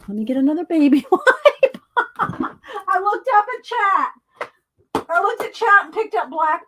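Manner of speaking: laughing through the words; giggling at her own slip. Real, not acted.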